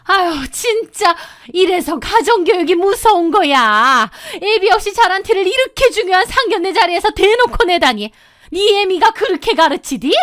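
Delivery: high-pitched, cutting tirade; sharp, fierce mother-in-law. A narrator's reading. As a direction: lash out at the bride in a high, biting voice. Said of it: "특유의 앙칼진 목소리로". (앙칼진 시어머니 목소리)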